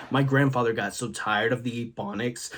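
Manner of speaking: usual speaking voice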